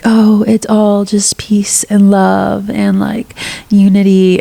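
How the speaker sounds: slow, airy breathy whisper mocking fake serenity; mock toxic positivity. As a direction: float it out like a dreamy guru. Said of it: "which is like".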